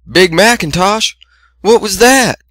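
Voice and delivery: high calling-out sister voice. A narrator's reading.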